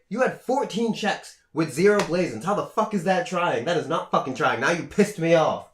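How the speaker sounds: a touch of an angry boss; retold rant. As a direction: rant at him, mildly hammed. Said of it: "he's just, like, ranting".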